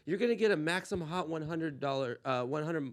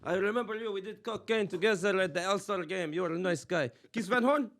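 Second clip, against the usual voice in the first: Uncle Raheem voice. Acted. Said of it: "gonna bring in my Uncle Raheem".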